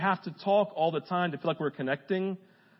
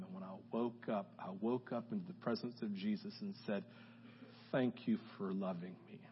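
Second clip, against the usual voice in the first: hushed testimony; 10 semitones lower, 12 dB quieter than usual. Real, not acted. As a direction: draw the congregation in, almost whispering. Quiet emotional testimony climax.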